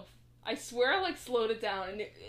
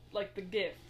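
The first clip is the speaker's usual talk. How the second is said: ordinary talk, nothing marked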